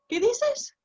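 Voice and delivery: high-pitched puzzled question; mock bewildered asking; acted confusion. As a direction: re-enact being baffled, voice shooting up. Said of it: "I honestly didn't know what she was saying".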